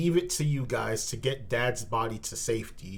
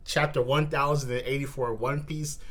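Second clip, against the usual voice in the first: ordinary talk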